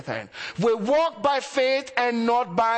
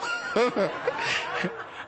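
breathy laugh; 4 semitones higher, 4 dB quieter than usual. Real, not acted. Laughing at the girl's remark.

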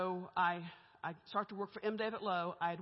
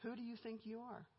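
hushed, pointed question; Holy Spirit; 2 semitones higher, 9 dB quieter than usual. Retold or imitated. Voice from God answering a wish for compassion, quiet rebuke. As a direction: ask it softly, as a gentle check. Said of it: "the Holy Spirit said".

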